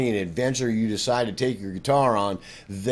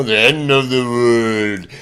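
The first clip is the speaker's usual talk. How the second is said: loud, put-on apocalyptic voice; mock doom; 2 semitones lower, 6 dB louder, slower than usual. Teacher lists what film scores must convey, mock doom. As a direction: push it out like over-dramatic movie doom.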